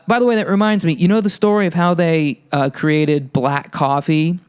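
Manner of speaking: ordinary talk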